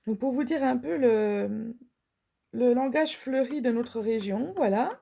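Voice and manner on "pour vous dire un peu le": ordinary talk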